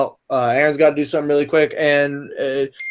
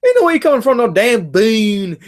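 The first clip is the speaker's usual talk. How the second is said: mock Southern disbelief; exaggerated Southern twang, incredulous; Southern accent quote; 6 semitones higher, 4 dB louder than usual. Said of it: "he says".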